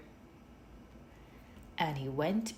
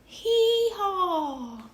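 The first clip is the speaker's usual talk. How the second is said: much higher, loud hee-haw bray; donkey bray; 8 dB louder than usual. A narrator's reading. The donkey joins the noise, a high loud bray. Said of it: "The donkey said".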